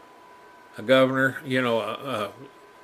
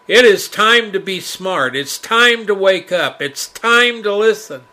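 loud, rising sermon warning; fiery preaching; 5 semitones higher, 7 dB louder than usual. Acted. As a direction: preach it with full force. Preacher warns of judgment.

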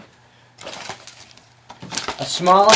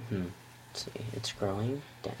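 whisper; 7 semitones lower, 17 dB quieter than usual. Real, not acted. Inspecting quietly.

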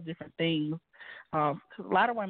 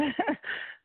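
a short high nervous giggle; nervous laugh; 11 semitones higher than usual. Real, not acted. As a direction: laugh it off shyly. Nervous laugh mid-sentence.

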